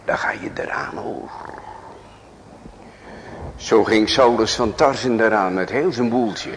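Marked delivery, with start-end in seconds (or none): 0.1-1.3 whispered warning. Acted. Preacher warning his hearers in a whisper. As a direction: breathe the warning close. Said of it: "whispered warning".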